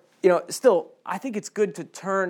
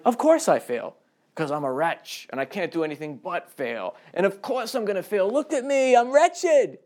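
mock self-pitying wretch; 5 semitones higher than usual. Preacher mocking a complacent believer: hammed-up wretched whine. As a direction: play the hopeless wretch, milking it. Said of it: "A sort of like".

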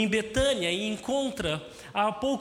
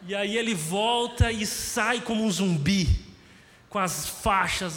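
ordinary talk

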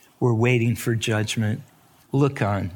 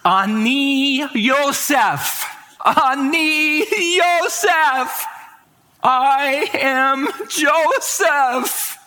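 emotional revelation; 13 semitones higher, much slower than usual. Acted. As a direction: proclaim it like a brother finally revealing himself. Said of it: "and he says".

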